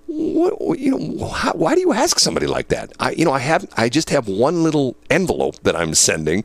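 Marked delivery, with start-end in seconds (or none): 0.1-2.9 higher-pitched mock indignation; taken aback. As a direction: stammer it out high, affronted. Retold first indignant reaction.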